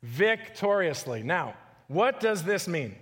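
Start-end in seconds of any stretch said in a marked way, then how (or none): 0.0-1.2 slow, weighty victoriously; drawn-out emphasis. Shown as a demonstration. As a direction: stretch out Victoriously, each syllable. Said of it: "He died victoriously".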